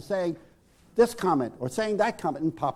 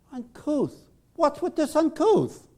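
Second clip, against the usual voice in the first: much higher, offended disbelief; indignant disbelief; 11 semitones higher, 4 dB louder, slower than usual. Retold or imitated. Rob offended by the word uncouth, indignant. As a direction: bristle at the word. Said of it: "Rob turns on Vicky".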